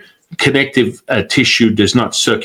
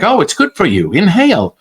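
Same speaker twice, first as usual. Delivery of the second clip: higher, cheery mock of the smoking mother; 7 semitones higher than usual. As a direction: sell the smoke like it's healthy.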